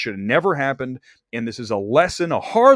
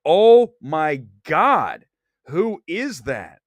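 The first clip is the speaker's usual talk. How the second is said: stretched-out, louder mock shock; shocked gasp; 4 semitones higher, 6 dB louder, much slower than usual. Seeing Jane Fonda in the trailer, mock shocked. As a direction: drag out the disbelief. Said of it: "I go".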